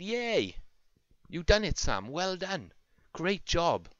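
high, excited cheer; cheering shout. A narrator's reading. Peppa and Daddy Pig thank Fireman Sam, cheering. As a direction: cheer the hero on in a high, lifted voice. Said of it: "Peppa and Daddy Pig shouted".

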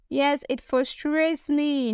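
usual speaking voice

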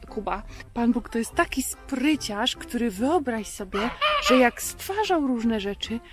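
normal talking voice

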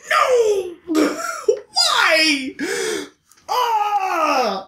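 high, disbelieving laugh cry; real exasperated laughter; laughing outrage. Real, not acted. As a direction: burst out laughing in protest at the plot. Outraged reaction to plot twist.

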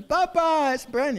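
put-on high, loud little boy's cry; a small son howling that it burns; child calling for papa. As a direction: yelp for daddy like a hurt child. Child calls father after burn.

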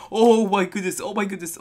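high, loud startled cry. Real, not acted. Gamer startled mid-fight, a high loud cry. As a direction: blurt it as you nearly die.

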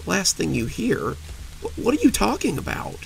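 quiet then jumping high; shocked fear. Acted. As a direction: disbelief turning to panic. Husband shocked by her words.

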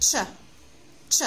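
"ch" as one short isolated sound; ch sound demo. Shown as a demonstration. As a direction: say "ch" crisply, like the start of "chicken". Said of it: "We pronounce them".

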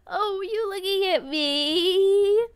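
high, slow character catchphrase; exaggerated figure asking if you're looking; put-on character voice. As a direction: draw it out high, rising on the last word. Voicing a game character's catchphrase.

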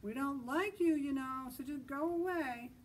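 shouted. Acted. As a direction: shout him away. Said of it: "The zebra shouted".

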